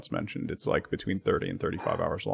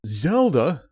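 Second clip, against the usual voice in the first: excited call-out; 6 semitones higher, 6 dB louder than usual. Real, not acted. Streamer greeting a new subscriber.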